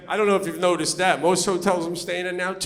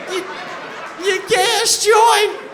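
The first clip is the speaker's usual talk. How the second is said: incredulous shout; 10 semitones higher than usual. Acted. Comedian stunned by the attendant's offer, mock incredulous shout. Comic incredulous at free gas joint.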